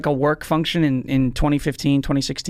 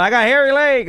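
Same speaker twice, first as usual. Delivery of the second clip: higher, loud old politician impression; Joe Biden yell; 7 semitones higher, 3 dB louder than usual. Guest screaming about hairy legs, loud impression. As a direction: yell it like a rambling president. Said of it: "Biden, I started screaming about".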